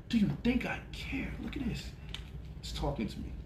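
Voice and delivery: whispered, mocking aside; hushed scoff at a hater's comment. Real, not acted. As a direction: whisper it to the camera.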